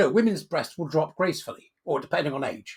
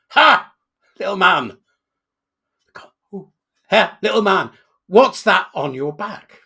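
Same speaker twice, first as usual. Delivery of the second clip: loud, drawn-out devil's challenge; devil's booming voice; 2 semitones higher, 11 dB louder, slower than usual. Acted. Devil accosting the hunchback in the graveyard, booming and slow. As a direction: boom it out like a menacing devil. Devil confronts man in joke.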